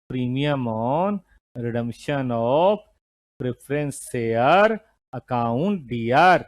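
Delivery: very slow, drawn-out words with pauses; slow dictation. Real, not acted. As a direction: dictate word by word for note-takers. Teacher dictates the entry to write. (धीरे-धीरे खींचकर लिखवाते हुए)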